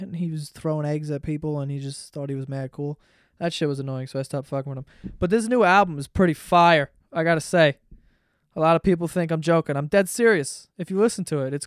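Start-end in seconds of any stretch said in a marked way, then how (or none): none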